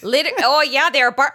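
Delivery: high, loud, badly faked Canadian; bad Canadian accent. Redoing it on request, bad mock Canadian accent. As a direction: attempt a Canadian accent and crack up. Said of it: "Do it some more".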